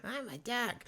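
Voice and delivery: high silly cartoon duck. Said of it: "Daffy Duck voice".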